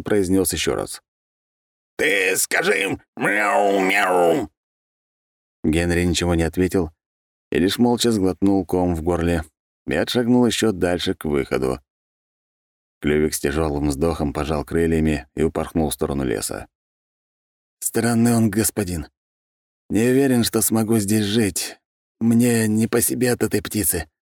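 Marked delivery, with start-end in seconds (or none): 2.0-4.5 much higher, slow echoing spirit voice; a ghostly bird insisting; otherworldly voice, raised. Acted. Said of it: "повысив тон". 17.8-24.1 higher, nervous servant voice; uneasy mutter. Acted. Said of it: "опасливо оглядываясь, пробурчал Генри".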